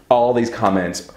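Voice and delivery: ordinary talk, nothing marked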